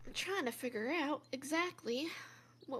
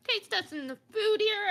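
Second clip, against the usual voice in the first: lightly Southern, higher and louder; Southern accent; 6 semitones higher, 5 dB louder than usual. Acted. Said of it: "I forgot my accent again".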